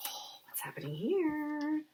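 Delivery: a hushed, breathy whisper. Acted. A faint voice calling to lonely Jake, whispered. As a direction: whisper it like a secret from the trees. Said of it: "a faint whispering call".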